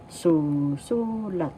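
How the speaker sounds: slow "susulat" with the second "su" held long; stress on second su. Shown as a demonstration. Teacher giving the example word, "susulat" slowly stressed on the second syllable. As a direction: stretch the middle syllable of "susulat", short "lat". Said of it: "The accent is in the second syllable".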